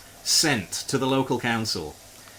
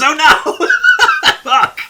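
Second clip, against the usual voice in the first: laughing dismay; 12 dB louder, slower than usual. Real, not acted. Park cleaning ruined, laughing.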